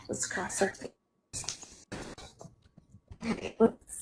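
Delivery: soft, slow whisper; whispered hope. Real, not acted. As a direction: breathe it out, fingers crossed. Hoping the connection holds.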